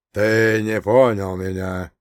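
slow, menacing villain voice; dark lord. A narrator's reading. Villain leader answers the hero.

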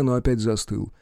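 normal talking voice

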